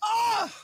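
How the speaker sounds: real shriek of delight; excited high-pitched cry. Real, not acted. Host erupts over pitcher's curveballs.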